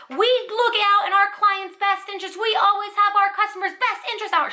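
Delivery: high-pitched mock yell; imitated yelling. Supervisor insists they protect customers' interests, imitated yelling. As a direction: act out a defensive supervisor's outburst. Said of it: "she started yelling at me".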